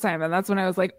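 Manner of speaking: ordinary delivery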